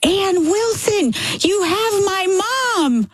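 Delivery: high, slow, mock calling out a name; a relieved son calling out across the driveway; retold yell. Son-in-law running out of the house, calling out high and slow. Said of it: "and he yells".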